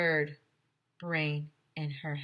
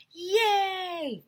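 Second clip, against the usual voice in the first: high squeal of delight; gleeful cheer; 13 semitones higher than usual. Acted. Boy cheering at news of a substitute teacher, high squeal. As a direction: cheer like a kid who just got a sub. Narrator voices kid's cheer.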